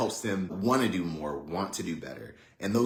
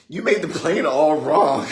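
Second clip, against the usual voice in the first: high, loud mock kid complaint; little sister voice; 7 semitones higher, 9 dB louder than usual. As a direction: accuse him loudly in a high kid voice. Little sister blames her brother.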